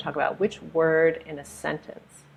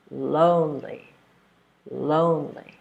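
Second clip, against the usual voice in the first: LONE-ly, first syllable long and strong, second light; first-syllable stress. Shown as a demonstration. Teacher modelling lonely for learners, stressed on LONE. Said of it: "stressing the first syllable".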